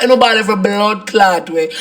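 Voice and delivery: ordinary talk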